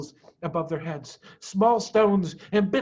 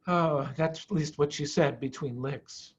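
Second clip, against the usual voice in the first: plain reading voice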